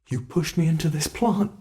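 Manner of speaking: ordinary talk, nothing marked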